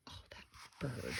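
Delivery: breathy whisper, fast; whispered aside. Real, not acted. Parent tutting to child about the bird, whispered. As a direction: hush it like a fond complaint.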